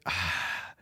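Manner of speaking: soft, low sigh of reluctance; exasperated sigh. Retold or imitated. To petitioners he half agrees with, soft exasperated sigh. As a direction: let out a soft, resigned breath.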